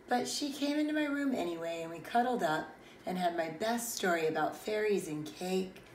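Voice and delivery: plain reading voice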